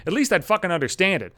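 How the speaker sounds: ordinary talk, nothing marked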